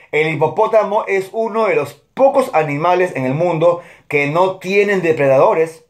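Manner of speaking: normal talking voice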